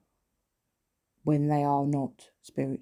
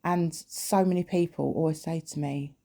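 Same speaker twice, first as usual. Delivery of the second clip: normal talking voice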